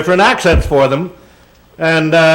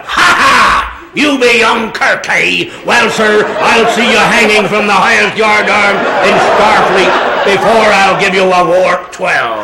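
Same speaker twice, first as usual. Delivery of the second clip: pirate-style dialect; 4 semitones higher, slower than usual. Acted. Engineer defying young Kirk, blustering pirate voice. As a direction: crow and threaten like a stage pirate, pitched up. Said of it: "they had picked some other dialect".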